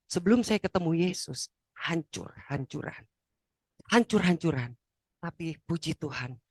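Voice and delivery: usual speaking voice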